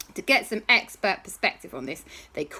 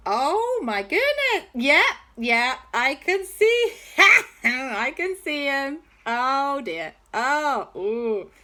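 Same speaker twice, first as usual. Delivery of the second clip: excited discovery; 6 semitones higher, slower than usual. Acted. J B peers through goggles.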